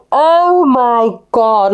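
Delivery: long, drawn-out dismay; retold shock. Driver retelling seeing her crashed car, drawn-out shock. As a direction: stretch out each word in mock horror.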